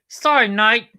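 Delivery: high, loud mock assistant reply; Siri, as retold by platypus. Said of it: "She said".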